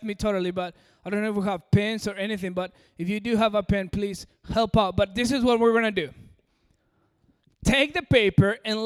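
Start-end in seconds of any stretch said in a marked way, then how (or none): none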